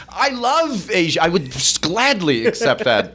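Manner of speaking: high-pitched, drawn-out gushing declaration; emphatic protest. Retold or imitated. Man denying he hides his heritage, emphatic high protest. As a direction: protest with exaggerated love, pitch way up.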